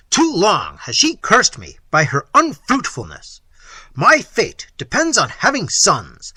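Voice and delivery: loud, commanding declaration; Manfred imperiously overruling Isabella. Acted. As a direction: lay down the law like a tyrant. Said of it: "said Manfred imperiously".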